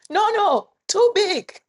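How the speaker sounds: high, loud, slow mock Vietnamese accent of a market woman; hammed-up Vietnamese vendor accent; imitating Vietnamese accent. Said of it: "she said it with an accent".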